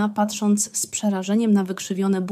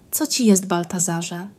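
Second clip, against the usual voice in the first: ordinary delivery, nothing marked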